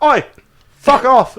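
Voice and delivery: loud, high mock-British heckle; exaggerated British street shout; British accent. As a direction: call out like a cheeky Brit. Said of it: "A British person can come up".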